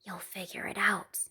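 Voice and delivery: soft unvoiced whisper. A narrator's reading. Parents whispering to sleeping daughter, soft whisper. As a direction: breathe it like a bedtime secret. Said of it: "They kissed her and whispered".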